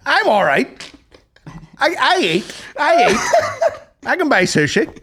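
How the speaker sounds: high, hammed-up wounded pride; mock indignant protest. Insisting to friends they can buy sushi, mock indignant.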